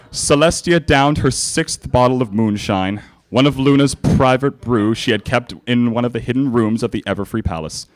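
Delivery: ordinary talk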